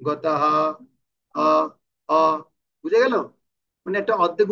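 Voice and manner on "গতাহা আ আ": গতাহা closing on a complete "haa"; full h ending. Shown as a demonstration. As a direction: give the last আ of গতাহা its whole length. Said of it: "শেষেরটা পূর্ণ উচ্চারণ হবে". (গতাহা শেষে পূর্ণ হ)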